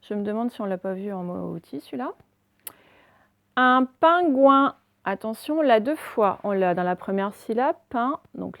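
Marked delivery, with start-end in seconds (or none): 3.5-4.8 slow, loud, higher dictation; deliberate classroom word reading; syllable by syllable. Shown as a demonstration. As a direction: sound the word out slowly for pupils. Teacher sounds out exercise word.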